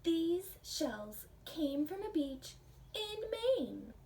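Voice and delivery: put-on slow, proud child showing off shells. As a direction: say it like a kid sharing a treasure. Child in story answers.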